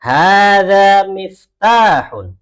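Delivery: slow, loud, drawn-out Arabic, every syllable of "Hada masjidun" stretched; slow model reading. Shown as a demonstration. Teacher modelling Arabic for the class, slow loud recitation. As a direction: chant it slowly for the class to repeat. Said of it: "yang lain ikutin".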